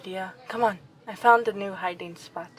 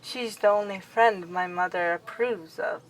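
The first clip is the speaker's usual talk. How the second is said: ordinary talk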